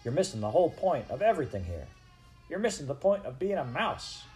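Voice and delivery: exasperated scolding. Acted. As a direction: scold him with big swoops in pitch. Exasperated brother scolding Despereaux.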